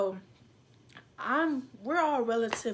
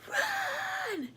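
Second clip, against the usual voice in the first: shouting run; 4 semitones lower than usual. Retold or imitated. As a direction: yell at them to run for it. Kid shouting to siblings to flee.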